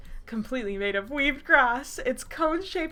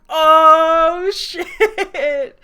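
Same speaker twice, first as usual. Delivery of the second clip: loud, high, laughing exclamation; mock shock; 6 semitones higher, 12 dB louder than usual. Real, not acted. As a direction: holler it out, drawn long. Reacts to her story's reveal.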